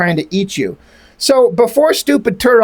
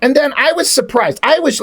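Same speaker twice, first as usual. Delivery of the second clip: usual speaking voice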